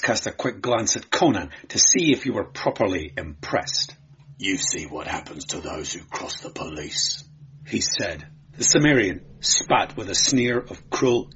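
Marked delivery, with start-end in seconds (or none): 4.4-7.2 menacing warning. A narrator's reading. Bully warns Conan after beating.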